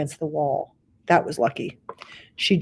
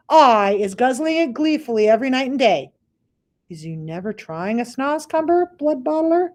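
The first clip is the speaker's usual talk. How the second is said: higher, lightly voiced friendly giant; BFG's voice; 7 semitones higher than usual. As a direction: coax him sweetly. BFG tempting the giant.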